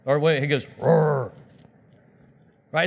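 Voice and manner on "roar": mock lion roar; half-hearted monster growl; bad-costume roar. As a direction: play a feeble beast trying to scare. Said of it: "like a bad Halloween costume".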